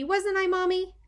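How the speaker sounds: put-on high, sweet toddler question; childlike plea; little child voice. A narrator's reading. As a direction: ask Mommy like a small kid. Toddler asking mother.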